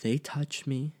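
quietly steady yourself like a calm mantra; a low, calm inner mantra. Shown as a demonstration. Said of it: "you have to stop, clear your head".

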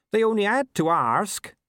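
high, loud plaintive protest; wailing farmer. A narrator's reading. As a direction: wail it as the wronged farmer. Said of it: "he wailed".

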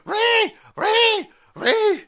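high loud mocking screech; mock meltdown screech. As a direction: shriek like an outraged troll.

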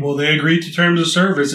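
dismissive mock-official retort; mocking quote. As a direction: parrot their smug excuse. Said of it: "and be like".

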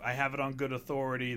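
ordinary talk

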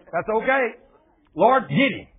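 loud, high, confident cry; calling on the Lord. Acted. Israelite calling on the Lord, loud confident cry. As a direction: call out boldly for help.

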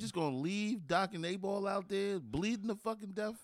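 high, heated mock outrage; indignant retelling, pitched high; retold outrage. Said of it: "And Animal Mother was like".

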